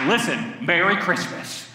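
usual speaking voice

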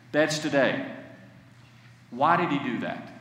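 ordinary talk

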